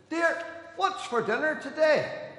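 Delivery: slow, high, loud shout; calling out. Acted. Husband testing his wife's hearing from the door, calling out. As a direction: holler slowly from the front door. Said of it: "down the hall and shout".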